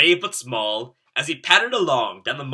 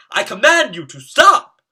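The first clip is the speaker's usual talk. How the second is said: loud, high knightly command; shouting command; 9 semitones higher, 12 dB louder than usual. A narrator's reading. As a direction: order the monster to halt. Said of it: "shouted Kevin".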